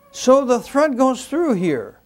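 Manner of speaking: ordinary talk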